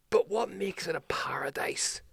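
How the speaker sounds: put-on whispered, puzzled drunk asking; hushed drunk question. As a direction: breathe the question out in a hush. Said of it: "he's like".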